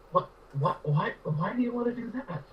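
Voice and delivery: put-on high, baffled kid's question; bewildered kid. Young boy asking the ranchhand, high and bewildered. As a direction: sound like a confused boy who doesn't get it.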